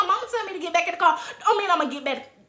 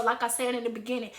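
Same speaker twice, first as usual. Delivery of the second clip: plain reading voice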